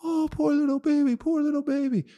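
mock a weepy tourist pitying the baby; mock crying tourist. Ridiculing tourists crying over the wildebeest, high whiny mock pity. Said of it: "fucking crying about".

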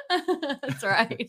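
laughing through That's right. Real, not acted. Agreeing with co-host about the challenge, laughing.

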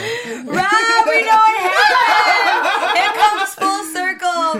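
host teasing co-host as Rob, laughing loudly; mock interview laughing. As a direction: tease him as Rob through laughter.

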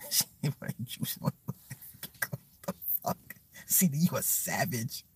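breathless, soft words through laughing; helpless laughter. Real, not acted. Streamer calling commenter a savage, helplessly laughing. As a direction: barely get it out for laughing.

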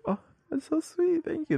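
chirpy thank-you voice; high sweet voice. Retold or imitated. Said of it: "I'm like".

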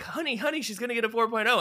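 very high, excited squeal of news; celebrating. Retold or imitated. [name] telling his partner about the grades, mock-celebrating. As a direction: call out in hammed-up joy, words tumbling. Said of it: "like, celebrating".

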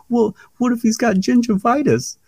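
very high comic squeak; funny high-pitched voice. Retold or imitated. Bray Wyatt objecting to Triple H, funny high-pitched. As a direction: imitate his worried question in falsetto. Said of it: "this real funny, high-pitched voice".

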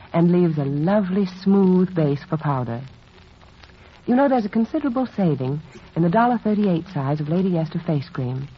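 ordinary delivery

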